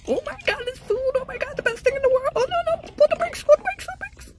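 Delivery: tiny kitten losing its mind over food; food-crazed kitten. Acted. Kitten rushing at its food bowl, squeaky and frantic.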